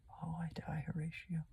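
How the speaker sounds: breathless whisper of a dying man; final whispered gasp. Acted. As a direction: barely get the words out. Hamlet's death line.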